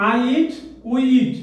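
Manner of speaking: slow, deliberate modelling; slow teacher model; slow dictation. Shown as a demonstration. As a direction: model it slowly for a learner. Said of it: "models the conjugation".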